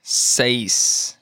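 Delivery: "Seis" drawn out slowly; slow model. Shown as a demonstration. Teacher modelling vocabulary for learners, slow "Seis". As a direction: stretch out each sound of "Seis".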